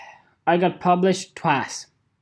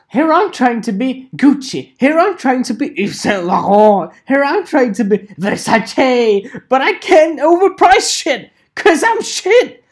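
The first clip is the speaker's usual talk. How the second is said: high, loud send-up building to a peak; hammed-up seller's confession; mock boastful seller; 7 semitones higher, 6 dB louder than usual. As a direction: rant as the overpricer, climbing higher and louder. Retakes the mock seller rant.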